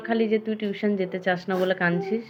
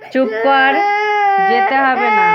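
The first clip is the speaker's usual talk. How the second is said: louder, sharper repeat of the order; loud scolding; 5 semitones higher, 6 dB louder than usual. Real, not acted. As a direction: snap it again, louder and higher. Repeats hush, much louder. (জোরে ধমক দিয়ে বলা)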